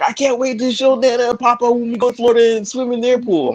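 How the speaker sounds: put-on high excited child's voice; little daughter voice. Daughter planning to show grandparents her swimming, high child voice. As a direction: gush like an eager little girl. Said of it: "And she was like".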